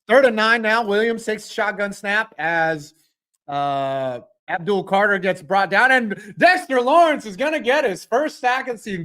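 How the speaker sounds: brisk announcer calling the snap; play-by-play call. Acted.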